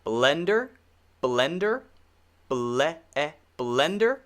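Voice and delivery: blender with a clear short eh, rising at the end. Shown as a demonstration. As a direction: isolate the eh, then say blender carefully. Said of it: "Once again, short E".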